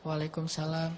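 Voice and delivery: reply so softly it can barely be heard; very soft, low-voiced return greeting; hushed reply. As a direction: answer the greeting under your breath on purpose. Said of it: "Karena saya pelankan suara".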